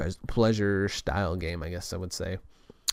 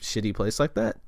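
ordinary delivery

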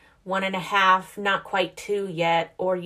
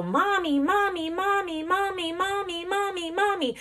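put-on high, repeated toddler whine of mommy; toddler calling mommy; 11 semitones higher than usual. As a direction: imitate a toddler calling for mom. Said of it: "She'll go".

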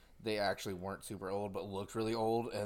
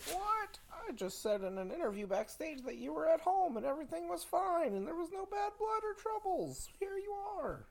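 much higher shocked woman's voice; Charlotte mock shock. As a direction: squeal it in disbelief. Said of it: "Charlotte's like".

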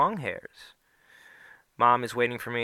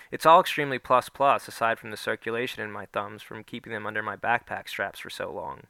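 ordinary delivery, nothing marked

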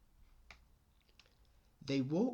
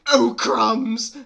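high, very loud yelp; hammed-up loud alarm; alarmed snake; 10 semitones higher, 19 dB louder than usual. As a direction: cry out in mock panic.

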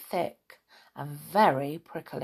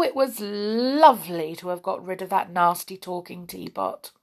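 ordinary delivery, nothing marked

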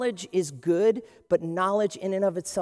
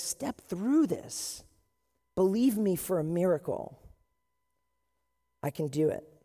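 gentle God voice; 3 dB quieter than usual. Acted.

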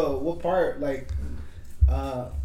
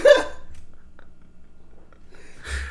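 high laugh trailing into wheezes; breathless laughter. Real, not acted. Admitting years of believing wrong, breathless laughing fit. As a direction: collapse into real laughter.

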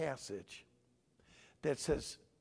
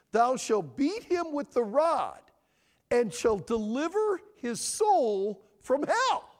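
loud, preaching Bible reading; forceful scripture reading; 5 semitones higher, 8 dB louder, slower than usual. Acted. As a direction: proclaim the verse to the congregation. Reads next verse slowly.

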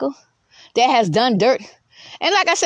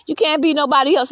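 ordinary talk, nothing marked

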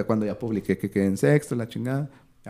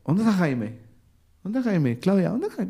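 higher, urgent calling for someone; calling out for Jaime; 8 semitones higher than usual. Retold or imitated. Claudia looking for Jaime after the cut, calling higher. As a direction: search the crowd for him, voice pitched up. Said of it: "de repente escucho".

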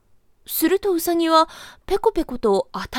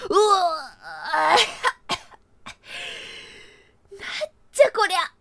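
put-on disgusted old man; 7 semitones higher than usual. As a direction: spit it out in shock. Grandpa spits out the drink.

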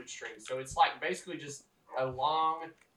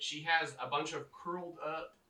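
ordinary delivery

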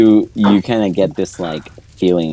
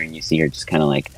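normal talking voice

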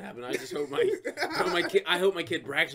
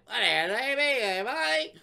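Australian; 8 semitones higher, 4 dB louder, much slower than usual. Retold or imitated. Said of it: "I started talking Australian".

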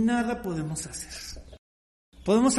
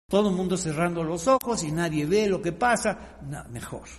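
ordinary talk

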